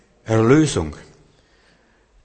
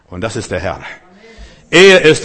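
usual speaking voice

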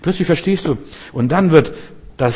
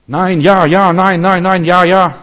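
a hint of clashing voices, higher and louder; confused inner voices; 5 semitones higher, 5 dB louder, slower than usual. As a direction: toss yes and no back and forth like quarrelling voices. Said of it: "dann hörst du plötzlich Stimmen". (wirre Stimmen im Kopf, leicht angedeutet)

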